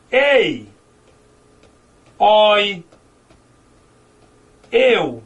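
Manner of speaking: "Ei", "oi", "eu" each said alone, open and loud; teacher drilling the open diphthongs. Shown as a demonstration. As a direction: sound out "Ei, oi, eu" with long pauses. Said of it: "Pra você memorizar".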